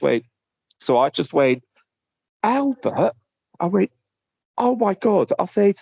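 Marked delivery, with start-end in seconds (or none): 2.4-3.1 high, delighted "Albert?" of mock recognition; mock surprise. Teasing a scam caller, high mock recognition. 4.6-5.3 high, gushing gasp of fake joy; mock delight. Winding up a scam caller, high fake delight.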